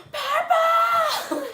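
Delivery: very high, full-volume cheer shout; hammed-up screamed cheerleading chant; yelled cheer. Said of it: "yelling the cheers louder than anybody".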